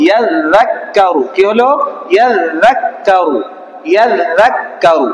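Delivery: ইয়াজাক্কারু stretched slowly, syllable by syllable; teacher reading ইয়াজাক্কারু very slowly for pupils to copy; slow drawn-out reading. Shown as a demonstration. Teacher models ইয়াজাক্কারু very slowly for the class to repeat.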